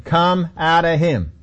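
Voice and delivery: slow, drawn-out order, said over; repeated command. Acted. Jesus kept saying it to him, slow repeated command. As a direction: repeat it slowly and deliberately. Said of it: "He kept saying to him".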